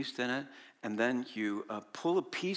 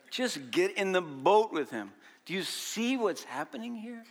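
usual speaking voice

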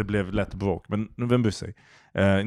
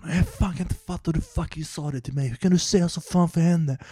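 mock drunk quarrel, pitched higher; drunk couple fighting; 9 semitones higher than usual. Retelling the drunk passengers fighting in back, mock yelling. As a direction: imitate a drunk guy yelling at his girlfriend, lightly hammed. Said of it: "De bara skriker".

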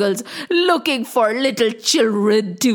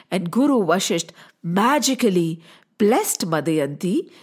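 plain reading voice

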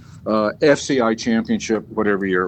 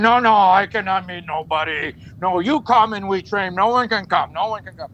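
higher-pitched, emphatic refusal in broken English; Fritz; 6 semitones higher than usual. Retold or imitated. Trainer refusing a visit from the team, imitated stern refusal. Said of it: "you gotta know Fritz".

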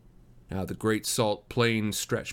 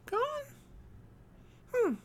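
put-on tiny high child voice, questioning; very high, childish wondering; little girl; 6 dB quieter than usual. A narrator's reading. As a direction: puzzle it over like a small girl. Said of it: "said the little girl".

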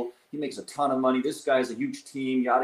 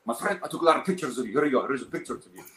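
put-on Arabic accent; 3 semitones higher, faster than usual. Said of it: "so he has his accent".

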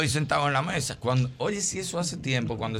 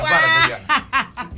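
high, slow, laughing plea; pleading quote; 12 semitones higher, 3 dB louder, much slower than usual. Retold or imitated. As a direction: beg her to stop, drawn out. (súplica lenta y aguda entre risas)

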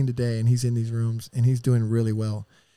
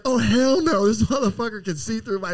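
mock girls refusing; 8 semitones higher than usual. The girls turning down the tweaker, high mock squeal. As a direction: squeal it like disgusted party girls. Girls quoted rejecting him.

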